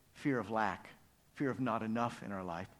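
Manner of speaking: ordinary talk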